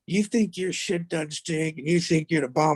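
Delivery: high, lightly voiced taunting coach; sneering coach. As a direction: jab at the player's ego. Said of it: "But he was like".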